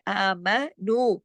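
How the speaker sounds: आमनु with long आ and long नु; measured, sustained आमनु; lengthened vowels. Shown as a demonstration. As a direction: recite आमनु slowly, stretching first and last vowels. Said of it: "नू दो हरकत खींचेगा".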